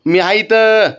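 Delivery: high, drawn-out call to a dog; calling out reassurance. Real, not acted. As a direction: call out to let the dog know you're there. Reassuring restless dog from across.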